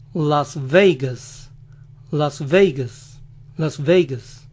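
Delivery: Vegas as veigas, slow, American accent. Shown as a demonstration. Showing how Americans say Las Vegas, American accent. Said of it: "también lo aplican en Las Vegas".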